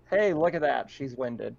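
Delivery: usual speaking voice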